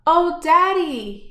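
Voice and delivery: high, eager call to his father; excited shout. Acted. Little boy runs to the truck, excited call. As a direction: call out to Daddy, thrilled. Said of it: "he shouted".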